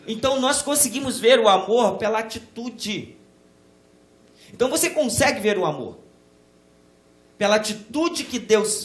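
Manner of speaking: loud, rising pulpit fervour; preaching at full force. Acted. Pastor urging the church to see love, fervent preaching. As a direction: preach it out to the pews.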